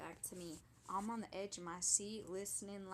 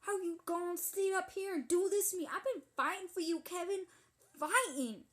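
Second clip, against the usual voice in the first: loud, fast, higher angry yell; mock screaming; 8 semitones higher, 7 dB louder than usual. A woman screaming at her cheating boyfriend Kevin. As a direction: tear into Kevin at the top of your lungs. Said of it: "she's screaming".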